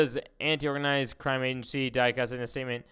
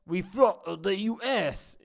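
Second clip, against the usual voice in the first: higher, slow, put-on British voice; mock Tate; 7 semitones higher, 4 dB louder, much slower than usual. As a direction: ham up the tough guy boast. Mocking Tate quote.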